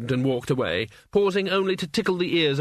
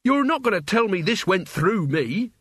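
snapped; 6 semitones higher than usual. Acted. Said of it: "he snapped".

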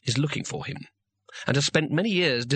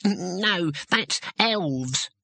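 higher-pitched, hesitant gnome voice; fussy gnome; 5 semitones higher, much slower than usual. A narrator's reading. Gnome correcting talk of tooth fairies, fussy.

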